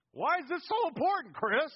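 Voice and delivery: high, loud mock question; questioning congregant. Preacher voicing his congregation asking him why, high mock question. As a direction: pipe up loudly as a puzzled listener.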